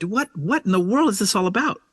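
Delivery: high, incredulous outcry; frustrated retold cry to the Lord; exasperated at God. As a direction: throw up your hands at heaven. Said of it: "he's mad at the Lord".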